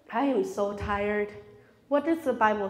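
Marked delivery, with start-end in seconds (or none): 0.1-1.3 weary. Pretend. Voicing a believer's weary thought.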